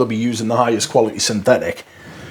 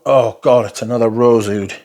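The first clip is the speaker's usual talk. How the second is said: ordinary delivery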